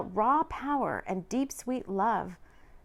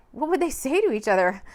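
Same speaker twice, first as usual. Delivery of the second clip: ordinary delivery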